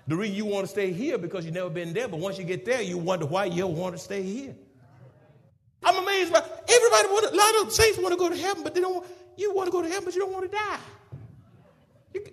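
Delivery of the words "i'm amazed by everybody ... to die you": high-pitched, rapid exclaiming; amazed preaching. Acted. Preacher chiding saints who fear dying, high amazed preaching. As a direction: rattle it off high in preacherly disbelief.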